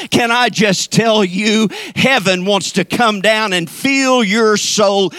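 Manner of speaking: loud, emphatic revival preaching; full-force preaching. Real, not acted. Pastor rallying the church that God will come, preaching at full force. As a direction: drive each phrase home with rising fervor.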